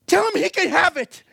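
high, emphatic cry of surrender; imitated yell. Preacher acts out Red surrendering his life to God, high yell. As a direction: act out shouting a wholehearted yes. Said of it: "he yells at the top of his lungs".

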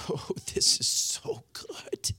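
soft breathy whisper of delight. Real, not acted. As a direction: whisper it, savoring. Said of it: "whispers in delight".

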